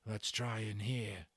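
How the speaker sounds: slow, hushed skinhead whisper; a soft, careful whisper in the dark; faint whisper. A narrator's reading. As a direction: breathe it out so no one hears. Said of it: "Then a faint whisper".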